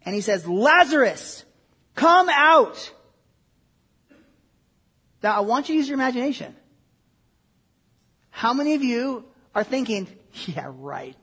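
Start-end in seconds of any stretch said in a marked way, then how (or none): 0.5-2.9 commanding shout, rising in force; loud cry. Acted. Jesus calling the dead man from the tomb, loud shout. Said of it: "he cried out with a loud voice". 10.5-11.2 very low, soft dismissive mumble; sceptical aside. Acted. Listeners thinking about the miracle, sceptical low mutter. Voices doubters' private thought.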